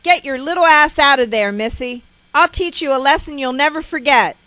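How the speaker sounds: loud, high, threatening mother; furious parental command at full volume; harsh shout. A narrator's reading. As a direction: yell threats at a hiding child. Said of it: "I hear her harsh voice".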